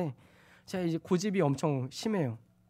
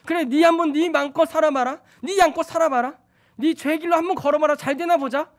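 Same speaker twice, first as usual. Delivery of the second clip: much higher, loud frustrated outburst; a fed-up parent shouting let's see how it goes; God voiced as an irritated father letting go; exasperated; 10 semitones higher, 11 dB louder than usual. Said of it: "너무나 짜증나가지고".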